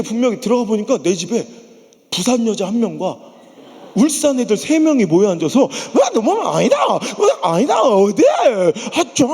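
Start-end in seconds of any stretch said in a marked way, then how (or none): 5.6-9.3 loud Gyeongsang family chatter; noisy Gyeongsang household; Gyeongsang dialect. Retold or imitated. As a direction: shout it in Busan dialect. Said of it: "사투리 좀 안 쓰면".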